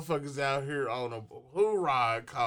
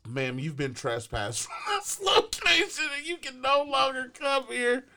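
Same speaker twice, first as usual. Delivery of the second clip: mock police officer; 9 semitones higher than usual. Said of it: "they're like".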